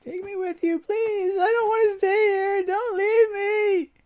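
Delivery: put-on a girl begging her family not to leave; high tearful child begging; crying child. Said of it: "Her cries mingle with tears".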